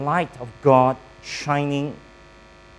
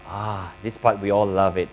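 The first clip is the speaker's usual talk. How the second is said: normal talking voice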